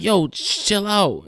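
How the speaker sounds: high-pitched, urgent mock plea; urgent hushing. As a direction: imitate telling a screamer to calm down. Friends calm screaming woman in robbery.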